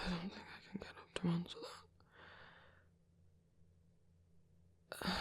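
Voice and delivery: barely voiced, drained whisper; weary whisper. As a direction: whisper it as if too tired to rise. Too unwell to get up.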